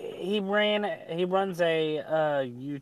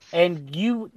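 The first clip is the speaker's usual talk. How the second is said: higher, louder retold rant; telling him off; 5 semitones higher, 5 dB louder than usual. Streamer retelling Faye's tirade at the debater, mock angry. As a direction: lay into him, mock fury. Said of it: "completely went off on him".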